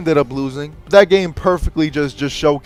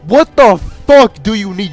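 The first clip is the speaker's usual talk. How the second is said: loud exasperated question; exasperated outburst; 4 semitones higher, 5 dB louder than usual. Real, not acted. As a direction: fling the question out. Rhetorical outburst.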